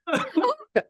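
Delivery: short high-pitched laugh; real, high burst of laughter. Real, not acted. Medium amused by the spirit's message, short high laugh.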